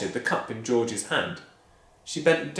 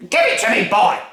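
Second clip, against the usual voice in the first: bully the whole family; furious Grandma; 9 semitones higher, 9 dB louder than usual. Acted. Grandma demanding her breakfast, loud bullying bellow. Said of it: "yelled Grandma".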